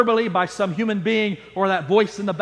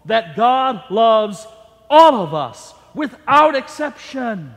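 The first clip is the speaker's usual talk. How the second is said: louder and very slow, pressing every phrase; emphatic preaching; 2 semitones higher, 6 dB louder, slower than usual. Acted. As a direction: proclaim it to the back pew. Preaching at full force.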